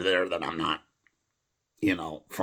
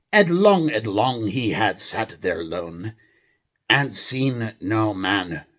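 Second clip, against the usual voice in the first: put-on English accent; 3 semitones higher, 5 dB louder, slower than usual. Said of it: "a normal English accent".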